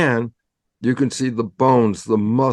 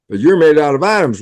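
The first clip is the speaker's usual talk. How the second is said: louder and quicker, hitting "you're" hard; emphatic punchline; 5 semitones higher, 6 dB louder, faster than usual. Acted. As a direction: land the punchline on the doubter. Punchline to the imagined skeptic.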